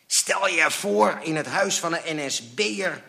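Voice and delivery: high, forceful hissed aside; angry hiss. A narrator's reading. Father imagining the owners as collaborators, hissing angrily. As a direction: spit it out in outrage. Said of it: "siste mijn vader".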